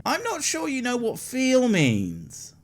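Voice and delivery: high, slow condescending jab; sarcastic. Real, not acted. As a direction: talk down to them.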